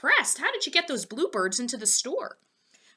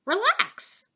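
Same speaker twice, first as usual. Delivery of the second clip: much higher easy voice; Fillmore calm; 12 semitones higher than usual. A narrator's reading. Fillmore telling Poppleton to relax, high and easy. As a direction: soothe him lightly.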